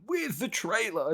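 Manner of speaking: high, slow whiny mock question; mock the impatient fans, high and drawn out; whiny complainer. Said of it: "are now going".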